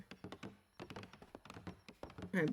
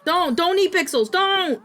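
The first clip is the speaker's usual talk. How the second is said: high pleading yell; 10 semitones higher, 8 dB louder than usual. Real, not acted. Pleading with follower.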